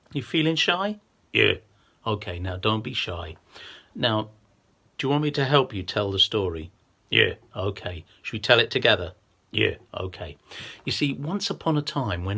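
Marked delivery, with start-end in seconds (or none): none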